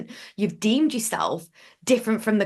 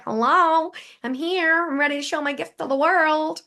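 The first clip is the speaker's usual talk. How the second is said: bright eager announcement; 7 semitones higher than usual. Retold or imitated. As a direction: sing it out like you've just woken up. Imagined self waking up, announcing.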